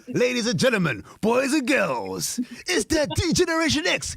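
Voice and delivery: high, slow, drawn-out ring announcer call. Pretend. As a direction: announce him like a wrestling entrance. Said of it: "That's how you introduce him".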